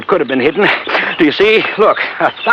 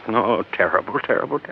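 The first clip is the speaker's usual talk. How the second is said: distressed murmur; 5 semitones lower, 8 dB quieter, faster than usual. Acted. Doctor recalling the scream and shots.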